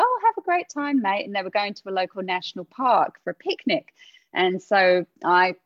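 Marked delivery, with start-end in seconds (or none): 0.0-1.2 high, bright cheery goodbye; breezy send-off. Retold or imitated. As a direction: wave him off all casual and upbeat. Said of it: "And I was like".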